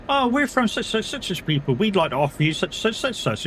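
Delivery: sales patter starting high, bouncing along; mock telemarketer. Telemarketer giving the company's offer on a call, mock sales patter. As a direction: rattle through a parody cold-call script.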